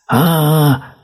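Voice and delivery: very high sustained "aaah"; storybook bear cub's high yell; acted wail. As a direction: holler one long high note. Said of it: "снова завопил медвежонок".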